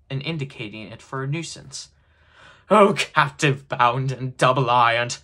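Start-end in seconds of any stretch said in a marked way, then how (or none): 2.7-5.2 loud, slow anguished lament; wailing ghostly outcry; crying out. A narrator's reading. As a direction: proclaim it like a tormented phantom. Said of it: "cried the phantom".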